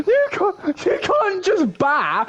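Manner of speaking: high, slow put-on Welsh voice; a hammed-up Welsh TV presenter, drawn out; lightly voiced Welsh presenter, high and slow; mock Welsh accent. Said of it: "Alex Jones from the One Show".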